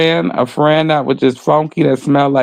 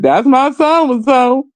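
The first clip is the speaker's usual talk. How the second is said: mocking quote; 9 semitones higher, slower than usual. Mocking people who come back.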